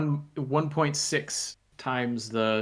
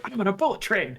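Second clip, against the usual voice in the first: higher, excited retelling of a first bullet-train ride; imitating own giddy self, pitched up; 8 semitones higher than usual. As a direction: bubble over like a kid on a train. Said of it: "I was practically giddy".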